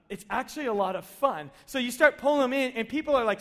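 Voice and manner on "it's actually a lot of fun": ordinary delivery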